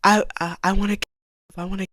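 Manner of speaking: starts loud and fades to near silence; pleading mantra that sinks away; a hint of a broken plea, dying out; desperate repeating. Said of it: "I remember repeating to myself".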